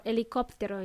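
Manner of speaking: helicóptero in Spanish, silent h; helicóptero, Spanish vowels and no h; Spanish pronunciation. Shown as a demonstration. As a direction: say "helicóptero" as "eli-KOP-tero". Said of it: "in Spanish".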